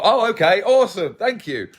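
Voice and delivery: high, loud, falsely chirpy thanks; mock cheery. Retelling being insulted to viewers, mock cheerful. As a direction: toss off a sarcastic bright thank-you. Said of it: "I'm like".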